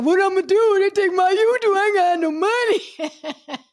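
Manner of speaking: high, loud panicked imitation, laughing at the end; mock panic. Mocking panicked YouTubers who lost their income, in high loud mock panic. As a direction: wail it in exaggerated panic, mocking them. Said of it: "them motherfuckers were panicking".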